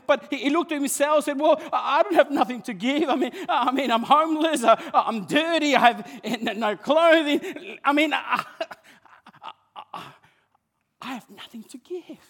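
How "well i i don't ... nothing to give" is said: put-on higher, laughing, halting child voice; a hint of a poor boy stammering; homeless boy. Preacher voicing the homeless boy, higher and halting.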